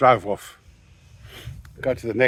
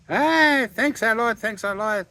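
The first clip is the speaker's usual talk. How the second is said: much higher, gushing thanks; suddenly all friendly on the phone; delighted thanks; 11 semitones higher, slower than usual. Retold or imitated. Owner delighted about credit.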